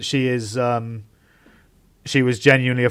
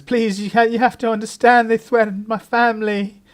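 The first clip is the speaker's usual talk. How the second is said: high, louder pleading, voice near breaking; pleading in tears; 11 semitones higher, 5 dB louder than usual. Acted. Celia breaking down in front of the inspector, high tearful pleading. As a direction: beg through mock tears. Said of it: "breaks down and starts crying".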